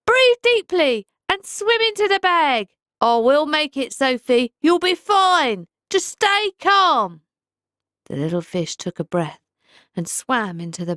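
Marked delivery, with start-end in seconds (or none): 0.0-7.2 urgent but soothing, slowing at the end; steady her through the panic; reassuring. A narrator's reading. Pike coaching trapped fish.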